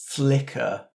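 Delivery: ordinary talk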